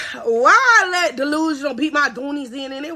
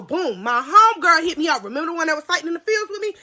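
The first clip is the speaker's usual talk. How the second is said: normal talking voice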